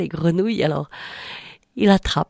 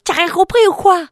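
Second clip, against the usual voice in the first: much higher, louder, scolding then begging; exasperated frog; 9 semitones higher, 5 dB louder than usual. Acted. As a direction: scold him, then plead almost in tears. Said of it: "la grenouille lui dit".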